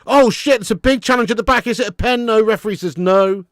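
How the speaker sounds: high, loud match reaction; excited live reaction. Real, not acted. Fan reacting to a big challenge at the back, excited and loud. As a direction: shout it as the challenge happens.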